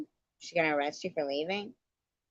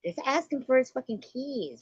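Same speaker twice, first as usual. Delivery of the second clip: exasperated; 10 semitones higher than usual. Real, not acted. Exasperated high advice.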